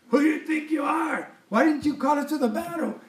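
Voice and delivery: shouted high, accusing; a high accusing shout at Gideon; angry accusers. Retold or imitated. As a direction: hurl the question at him like angry men.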